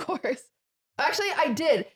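ordinary talk, nothing marked

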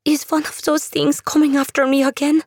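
put-on a frightened girl asking; fearful young woman. Andula, fearful.

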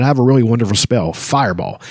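ordinary talk, nothing marked

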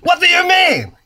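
outraged mock French accent, rising high. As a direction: play an indignant Frenchman, high and loud.

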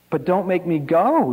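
put-on high-pitched child's desperate plea; narrated pleading, voice rising high; begging. A narrator's reading. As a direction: beg like a small boy, pitch climbing to the end. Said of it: "he begged".

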